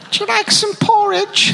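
very high mock Cockney greeting; a bad English accent, chirpy ghost; shitty English accent. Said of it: "shitty English impression".

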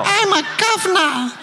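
very high mock Cockney greeting; a bad English accent, chirpy ghost; shitty English accent. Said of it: "shitty English impression".

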